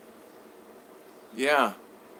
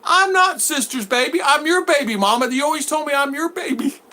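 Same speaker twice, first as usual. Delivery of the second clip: put-on high, whiny little-kid voice; child protesting; 12 semitones higher, 4 dB louder than usual. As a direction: insist like a hurt toddler.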